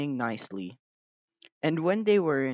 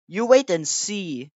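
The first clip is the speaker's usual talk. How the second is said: confident driver; 5 semitones higher, 7 dB louder than usual. A narrator's reading. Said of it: "said Edward's driver".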